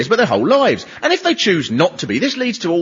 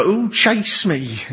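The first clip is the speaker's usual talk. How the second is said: slow camp drawl; milk it like a seventies sitcom camp character; camp sitcom catchphrase; much slower than usual. Retold or imitated. Comic imitating the sitcom catchphrase, camp drawl. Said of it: "that stupid bloke who used to go".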